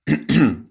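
loud theatrical throat clear. A narrator's reading. Ambre clearing her throat before her act, loud. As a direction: clear the throat to take the floor. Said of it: "Ambre se racle la gorge".

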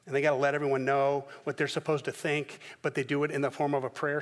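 normal talking voice

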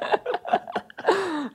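squealing laugh; a high laugh that bursts out; high burst of laughter. Real, not acted.

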